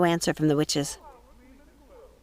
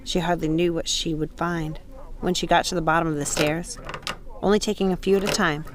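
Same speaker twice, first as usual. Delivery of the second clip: plain reading voice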